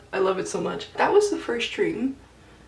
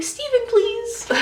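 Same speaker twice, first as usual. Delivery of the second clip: much higher, slow swoony plea; swooning request; 3 dB louder, slower than usual. Retold or imitated. Fangirl asking for Stephen, slow high swoon. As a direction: melt as you ask for him.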